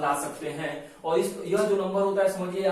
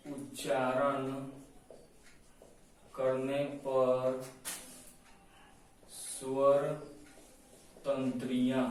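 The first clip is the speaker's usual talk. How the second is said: very slow, lower, words stretched out; slow dictation; 3 semitones lower, 5 dB quieter, much slower than usual. Shown as a demonstration. Teacher dictating a definition for notes, slow dictation. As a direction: dictate word by word with long gaps. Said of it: "ऐसे लिखिए".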